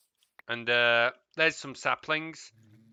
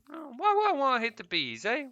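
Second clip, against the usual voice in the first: incredulous; 11 semitones higher than usual. Real, not acted. Scolding about bees.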